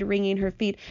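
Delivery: usual speaking voice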